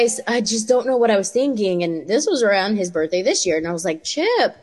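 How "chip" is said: high sing-out of a name; calling to child. Retold or imitated. Mother to her son before a question, high calling. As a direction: call his name high and sweetly coaxing.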